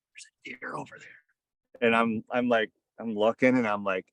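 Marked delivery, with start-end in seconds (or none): 0.1-1.1 soft unvoiced whisper. Son whispering to dad in the tree stand about a deer. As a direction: breathe it out without voice. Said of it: "Cole leans over and he goes".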